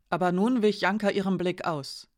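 usual speaking voice

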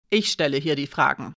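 firm, hard put-down; a touch of stern authority. A narrator's reading. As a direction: take control, brooking no argument. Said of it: "sagte Alina hart".

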